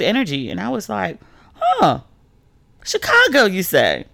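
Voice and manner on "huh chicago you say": high, drawn-out playful curiosity; mock intrigued. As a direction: pretend to be suddenly tempted. Teasing sudden interest in Chicago.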